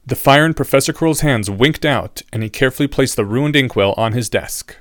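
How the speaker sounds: ordinary delivery, nothing marked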